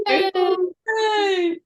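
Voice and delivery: very high, loud yay; high cheer. Shown as a demonstration. Leader cheers the group with a high yay. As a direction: squeal a happy yay. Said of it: "laughter yoga cheer".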